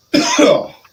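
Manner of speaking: loud hacking cough. Real, not acted. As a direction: cough hard. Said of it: "I'm sorry that I'm coughing".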